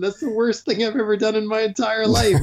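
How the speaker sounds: high, emphatic never-again voice; exhausted refusal. Retold or imitated. Worn-out rider refusing a local's invitation, high and emphatic. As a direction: swear off the race for good. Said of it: "And I'm like".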